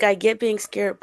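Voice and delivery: usual speaking voice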